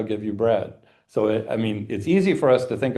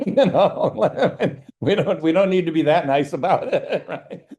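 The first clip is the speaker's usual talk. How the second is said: higher, laughing through the words; 6 semitones higher, 4 dB louder than usual. Real, not acted. Teacher laughing at her own joke to the class. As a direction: giggle while joking she needn't be nice.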